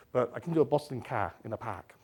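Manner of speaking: broad Boston accent with dropped r's. Shown as a demonstration. Speaker showing the audience his Boston accent. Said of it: "I can't do an Aus-, American accent for love nor money".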